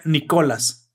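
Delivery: "Nicolás" stressed on the middle syllable, "ni-CO-las", falling; misplaced stress. Retold or imitated. Retelling a documentary's robot narrator, misplaced stress. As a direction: copy the synthetic voice's wrong accent in "Nicolás". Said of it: "con voz de Loquendo".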